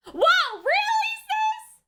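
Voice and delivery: loud, high, slow disbelief; can't believe what she sees; shocked. Real, not acted. Shocked at character's move.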